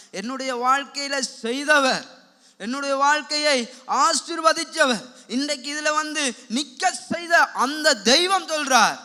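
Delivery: loud, slow, weighty proclamation; full-force preaching. Real, not acted. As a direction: declare it with force, word by word. (உரத்து, நிறுத்தி அறிவிக்கும் பிரசங்கம்)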